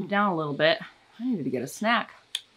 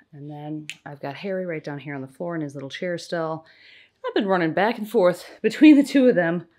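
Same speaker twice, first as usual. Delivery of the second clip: normal talking voice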